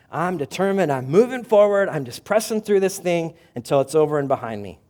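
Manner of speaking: loud, high, pushing through; gritted-teeth resolve. Retold or imitated. Someone enduring a trial, loud and gritted-teeth. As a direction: grit your teeth and press on. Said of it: "just grit your teeth".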